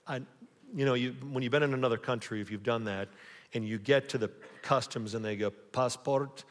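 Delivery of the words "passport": hammed-up German accent on one word; badly faked German accent; horrible German accent. Customs officer asking a traveler for a passport, bad German accent. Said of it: "that was a horrible accent".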